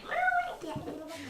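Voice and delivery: high squeaky ferret chitter; ferret impression. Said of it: "Perfect ferret impression".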